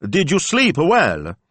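high, slow called-out question; loud, drawn-out friendly hail; shouted pleasantry. A narrator's reading. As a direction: call it out to the approaching ladies. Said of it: "Don Rocco shouted".

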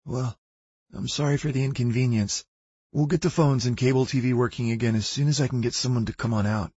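a hint of a jittery teenage clerk; nervous young clerk.

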